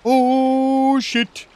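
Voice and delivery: high, loud yelling in alarm; panicked shout. Real, not acted. Gamer yelling as the boat sinks, loud alarm. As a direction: shout like the boat's going down.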